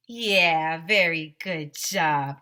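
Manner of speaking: plain reading voice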